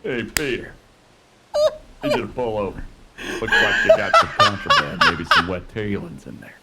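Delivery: commanding cop voice with laughter; barking police commands. Pretend. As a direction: traffic cop ordering a pull-over. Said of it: "I've been working on my cop voice".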